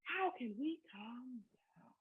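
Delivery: soft, slow whisper; whisper to a child. Shown as a demonstration. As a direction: hush it like a secret to a toddler. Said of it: "more animated with it".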